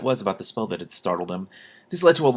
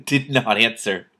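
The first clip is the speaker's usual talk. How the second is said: ordinary delivery, nothing marked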